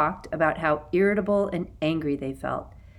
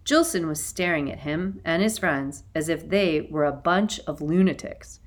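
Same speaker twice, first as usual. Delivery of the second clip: usual speaking voice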